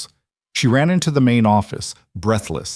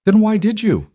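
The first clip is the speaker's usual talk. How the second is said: put-on high-pitched, louder hurt child; voice cracking; 13 semitones higher, 5 dB louder than usual. A narrator's reading. Girl asks why the woman left, voice cracking. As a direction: plead high, on the edge of cracking. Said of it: "Maddie's voice cracked".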